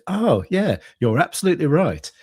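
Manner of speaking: eager, high people-pleasing AI voice; sycophantic chatbot. Retold or imitated. User catches the AI's mistake, it chirps back apologetically. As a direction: gush agreement like a too-helpful bot.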